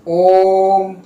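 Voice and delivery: ओम held out three times long and loud; tripled om. Shown as a demonstration. Teacher showing a pluta vowel to students, very long ओम. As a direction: draw out the ओ of ओम extra long. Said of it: "तिगुना हो रहा है ना?".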